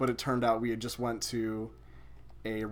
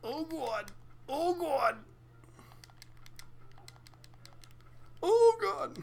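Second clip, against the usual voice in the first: panicked cries; 3 dB quieter, slower than usual. Real, not acted. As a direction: cry out in growing panic. Panics while racing in the game.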